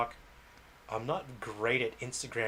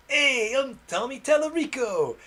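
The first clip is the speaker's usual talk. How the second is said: much higher, louder, slow showman cartoon voice; hammed-up Tommy Tallarico; 13 semitones higher, 7 dB louder than usual. Vlogger mocking a game figure's name, hammed-up announcer. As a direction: announce yourself like a Marvel hero. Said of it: "I don't know if that was a good impersonation".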